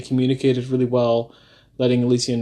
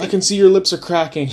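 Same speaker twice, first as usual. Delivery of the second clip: high, loud, insistent offering; mock-bossy hydration coach; nagging partner; 7 semitones higher, 3 dB louder than usual. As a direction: push the drinks on her. Partner nagging her to drink.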